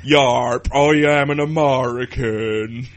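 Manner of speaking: loud, slow mock American drawl; botched American accent. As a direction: proudly overdo a terrible American accent. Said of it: "no idea what accent that's meant to be".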